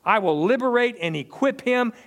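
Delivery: rising, forceful scripture reading; preaching. Acted. Pastor preaching a psalm to his church, building in force. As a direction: preach the verse out to the congregation.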